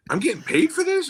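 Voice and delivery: high, incredulous retold question; acted amazement; astonished. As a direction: replay your disbelief, voice shooting up. Retold astonishment at news of pay.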